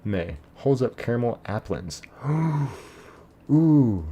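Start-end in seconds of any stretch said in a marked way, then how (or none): 2.1-2.8 sharp gasp. Real, not acted. Caramel applins held up on stream. 3.5-4.1 delighted ooh. Real, not acted. Delighted by the caramel applins.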